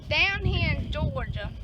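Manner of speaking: slow Georgia drawl; Southern accent. Real, not acted. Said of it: "Sorry for that accent".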